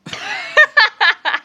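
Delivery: a loud, high-pitched laughing fit; shrieking laugh; high burst of laughter. Real, not acted. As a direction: crack up loud at your own joke. Laughing at own joke.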